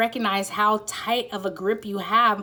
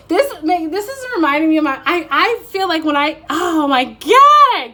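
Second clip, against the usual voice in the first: higher, louder, tumbling over her words; bursting with excitement she can't contain; overexcited; 8 semitones higher, 9 dB louder than usual. Real, not acted. Reader to viewers, too excited to finish a sentence, bursting out. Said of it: "I get so excited sometimes I cannot speak".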